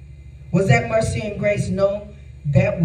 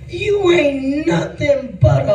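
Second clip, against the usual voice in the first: mock slow insult; 5 semitones higher, much slower than usual. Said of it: "I said".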